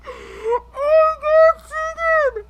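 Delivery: slow, high tearful cry. Real, not acted. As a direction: choke out the words while crying. Overwhelmed crying.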